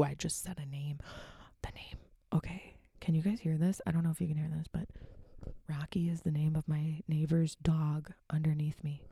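unvoiced hushed whisper. Real, not acted. Whispering about the neighbor's dog in her apartment. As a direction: whisper so the neighbor can't hear. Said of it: "I don't have to whisper in my own home".

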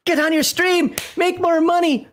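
high, squeaky nagging voice; imitating his wife telling him to get back to work; mock bossy wife. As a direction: nag him in a shrill put-on falsetto. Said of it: "She's like".

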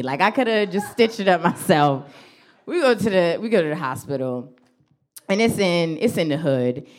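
ordinary talk, nothing marked

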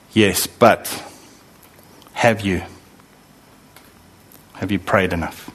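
low, hesitant preacher's caution; lightly voiced preacher planting doubt with pauses. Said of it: "often a preacher, unfortunately".

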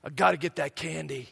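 low, soft, mock-determined kid voice; imitating a stubborn kid in a low murmur; determined little son. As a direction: drop low and quiet, doggedly single-minded. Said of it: "He goes".

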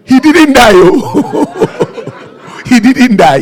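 delighted laughing cry. Real, not acted. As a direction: laugh through the news. Laughing exclamation of the outcome.

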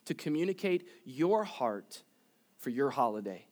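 soft, hushed prayer voice. Acted. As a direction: pray quietly to God. Closing prayer before sermon.

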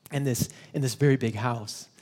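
usual speaking voice

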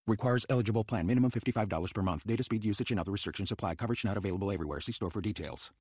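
super fast ad read. Acted. Legal disclaimer at end of ad.

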